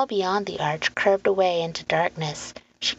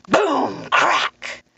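sudden high-energy exclamations; booming sound words; 9 semitones higher, 4 dB louder than usual. A narrator's reading. Narrator startling listeners with stone splitting open, booming. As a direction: burst out with each crash word. Narrator voices thunderous noise.

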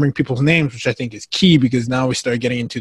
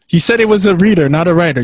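teasing; 5 semitones higher, 6 dB louder than usual. Real, not acted. Teasing friend about spelling.

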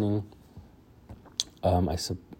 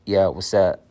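Dax Prescott answering; 7 dB louder than usual. Pretend. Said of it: "Let me call up Dax Prescott".

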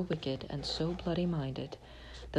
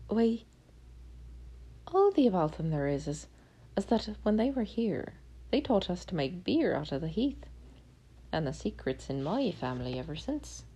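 leprechaun; 3 semitones higher than usual. Acted. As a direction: play the cheeky little leprechaun, pitched up. Leprechaun tells of Danes.

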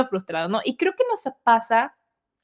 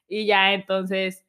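normal talking voice

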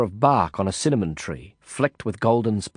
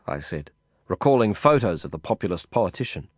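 usual speaking voice

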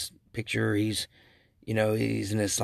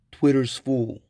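louder, lightly put-on movie-character voice; Cousin Eddie; 3 semitones higher, 6 dB louder, slower than usual. Host parodying a movie line as a warning sign, lightly voiced character. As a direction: announce it as a mock catchphrase, a bit louder. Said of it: "Cousin Eddie says".

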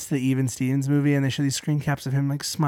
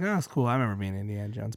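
much softer, lower wistful aside; wistful murmur; 7 semitones lower, 12 dB quieter than usual. Retold or imitated. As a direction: murmur it, lost in memory.

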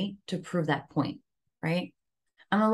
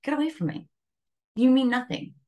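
brushing off a stranger, higher and sharp; telling off a rude stranger, dismissive snap; 6 semitones higher than usual. Retold or imitated. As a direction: shove the stranger away with the words. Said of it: "you'd be like".

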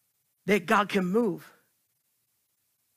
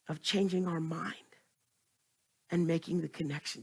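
whisper; 6 semitones lower, 10 dB quieter than usual. Real, not acted. Unvoiced aside in sermon.

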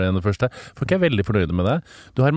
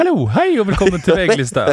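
high, raised presenter welcome; mock show host; 8 semitones higher, 4 dB louder, faster than usual. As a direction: open the show, parodying a host. Etterligner programlederens åpning som lydprøve. (påtatt høy programlederstemme)

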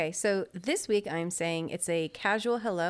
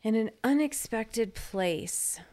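drawn-out, deliberate restatement; slow, clear repeat; 4 semitones higher, much slower than usual. Real, not acted. Co-host restating the question for listeners, slow and deliberate.

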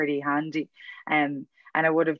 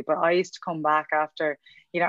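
usual speaking voice

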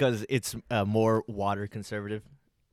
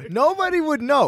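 high mock voice; 7 dB louder than usual. Mock repeat of line.

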